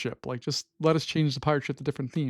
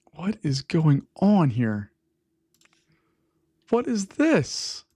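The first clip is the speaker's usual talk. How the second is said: annoyed; much slower than usual. Real, not acted. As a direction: drag it out like you can't believe the mess. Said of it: "All right, I'm annoyed".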